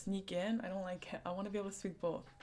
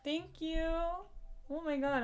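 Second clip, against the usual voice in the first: overwhelmed thanks; 6 semitones higher than usual. Real, not acted. Streamer thanking a viewer for a wish list gift, gushing. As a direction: bubble over with real gratitude. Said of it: "I'm gonna turn red".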